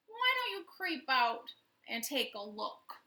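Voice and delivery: plain reading voice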